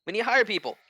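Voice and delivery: high, loud and fast crowd chant; riled-up crowd. Acted. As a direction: whip the crowd into agreement, fast. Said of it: "get riled up and be like".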